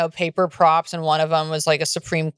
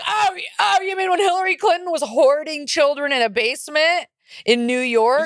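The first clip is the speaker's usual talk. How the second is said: sarcastic mock; 7 semitones higher than usual. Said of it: "I was like".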